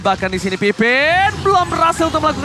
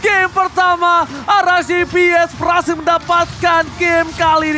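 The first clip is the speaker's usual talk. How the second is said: loud, high, stretched victory shout; fighting game announcer; 6 semitones higher, 3 dB louder, slower than usual. Acted. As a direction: scream the comeback to the arena. Game-winning hype call.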